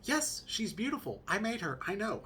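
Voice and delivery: high, lilting mock wife voice; imitated wife. Wife told to look at baby, high imitated voice. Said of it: "she's like".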